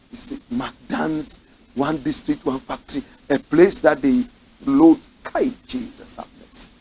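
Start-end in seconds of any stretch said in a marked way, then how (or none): 5.2-6.7 whispered exasperated plea. Real, not acted.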